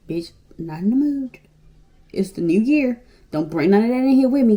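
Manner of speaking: loud, firm mock telling-off; brushing off drama for the new year, loud and firm; retold telling-off. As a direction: shut the caller down hard. Retold reply to caller.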